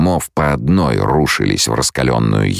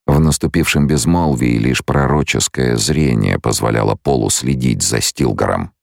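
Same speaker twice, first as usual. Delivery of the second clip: usual speaking voice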